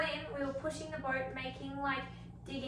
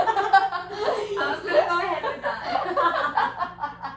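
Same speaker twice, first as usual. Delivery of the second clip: much louder, slow words through laughter; laughing, slow and loud; 6 semitones higher, 12 dB louder, much slower than usual. Real, not acted. Friend joining the croc joke, loud laughing. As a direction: play along while cracking up.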